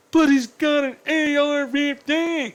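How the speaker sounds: high, drawn-out mocking whine; mocking objector. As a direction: parrot a silly objection in a slow, high put-on voice. Mocks an objector in put-on voice.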